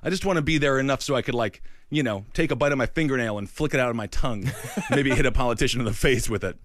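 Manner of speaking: ordinary talk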